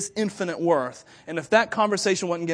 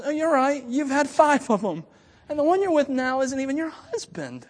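gentle acknowledgment; 8 semitones higher than usual. Retold or imitated. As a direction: tell her the truth tenderly. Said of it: "No, he goes, he goes".

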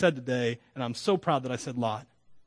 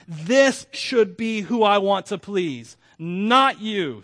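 rising, louder, slow preaching build; preaching at full force; 3 semitones higher, 7 dB louder, slower than usual. Acted. Quoting Tom Schultz, preaching.

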